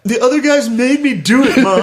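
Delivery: high tearful mock whine to mom; playing the victim. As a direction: imitate yourself wailing the blame onto others. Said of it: "I went with the victim".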